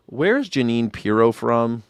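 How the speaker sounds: ordinary delivery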